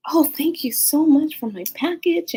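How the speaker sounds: high-pitched, effusive thanks; grateful lady. Retold or imitated. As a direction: imitate a thankful neighbor gushing. Retold lady thanking at the stairs.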